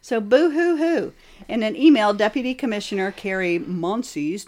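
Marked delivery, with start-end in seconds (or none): 0.0-1.1 mock sobbing boo-hoo; whiny pretend crying, slowly; fake crying. Mocking cry over lost funds.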